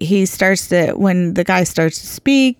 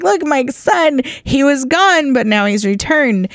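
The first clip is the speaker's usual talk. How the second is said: higher-pitched, elated announcement; excited father; 7 semitones higher than usual. Retold or imitated. Father shows everyone his son came home, excited and high. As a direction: proclaim the son's return with joy. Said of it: "he's so excited".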